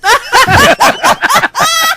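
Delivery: laughing at the joke; high laugh. Real, not acted. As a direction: crack up at your own bit. Laughing after his impression.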